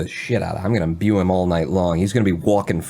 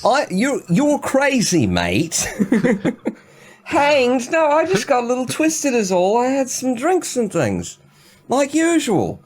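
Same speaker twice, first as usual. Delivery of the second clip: much higher, loud mock-British accent; put-on accent; 3 dB louder, slower than usual. [name] scoffs at the jailer in an overblown British accent. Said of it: "I'm insulting people with this accent".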